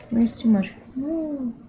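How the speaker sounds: ordinary talk, nothing marked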